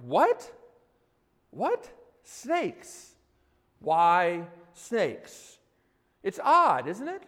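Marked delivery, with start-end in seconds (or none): 0.1-5.6 baffled disbelief. Acted. Preacher voicing listeners' reaction to the snakes, acted bafflement. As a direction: react as a puzzled reader, pausing between each question. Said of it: "And you're like".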